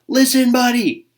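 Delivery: loud, high call of his name; yelling. Acted. Parents shout at Buddy to make him listen. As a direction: parents yell to get his attention. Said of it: "His parents tried yelling".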